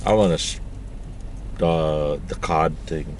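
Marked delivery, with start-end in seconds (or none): none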